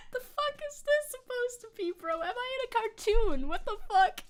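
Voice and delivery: high, loud disbelief; can't believe what just happened; shocked disbelief. Real, not acted. Outraged disbelief at the fly.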